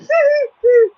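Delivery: high, taunting cackle; mock devil laugh. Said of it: "devil's just laughing like".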